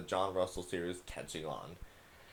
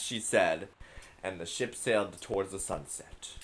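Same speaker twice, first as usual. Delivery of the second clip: epic voice; 3 semitones higher, 11 dB louder than usual. Pretend. Gamer reads a child's pirate story aloud, loud epic voice. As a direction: boom it out like a grand pirate saga. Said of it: "do a little epic voice".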